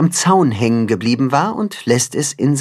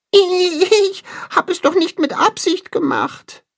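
very high, whiny little animal voice; protesting little Tacho. A narrator's reading. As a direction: plead innocence, drawing out the first word. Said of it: "beteuert dieser".

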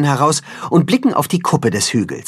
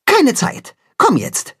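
short and urgent, much higher voice; curt; 11 semitones higher, slower than usual. Acted. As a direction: cut him off, no time to explain. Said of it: "antwortet knapp".

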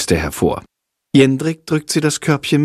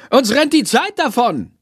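high, raised call; teacher calling out; 13 semitones higher than usual. A narrator's reading. Teacher calling to stressed class, high raised voice. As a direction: call it over the bustle. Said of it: "ruft sie".

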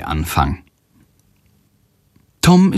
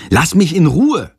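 higher, irritated snap; mildly snarled; 8 semitones higher than usual. A narrator's reading. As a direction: snap to be left alone, without shouting. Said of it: "faucht Jendrik". (leicht gereizt gefaucht)

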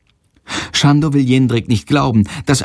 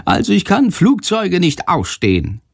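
bat voice; 8 semitones higher than usual. Acted. Bat Shandor's high voice.

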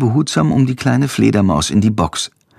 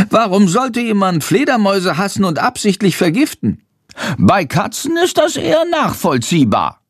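high-pitched outraged little bat; high character voice, affronted; indignant; 9 semitones higher than usual. A narrator's reading. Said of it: "Er ist empört".

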